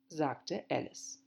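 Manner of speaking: ordinary delivery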